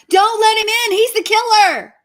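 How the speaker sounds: high, loud movie-spoiler yell; yelled warning. Pretend. Moviegoer warning the character on screen, yelled out high and loud. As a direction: shout it out across a whole cinema. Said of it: "yell out".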